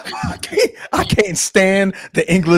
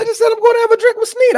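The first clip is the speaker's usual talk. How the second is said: mock whine; much faster than usual. Exasperated complaint to co-host.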